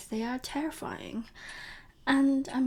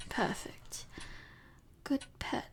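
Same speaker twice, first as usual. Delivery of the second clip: muttered aside; 11 dB quieter, slower than usual. Acted. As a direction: murmur it softly to yourself. Private aside, then "ignore me.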